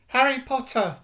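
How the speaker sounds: a small eager creature squeaking his name; high-pitched elf voice. Acted. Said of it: "in a high-pitched voice".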